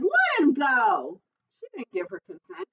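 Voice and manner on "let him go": high, slow demanding call; drawn-out protective yell; mother's shout. A narrator's reading. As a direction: demand his release, drawing it out. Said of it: "she shouted".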